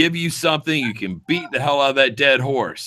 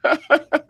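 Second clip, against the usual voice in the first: high burst of laughter. Real, not acted. Laughing at teasing.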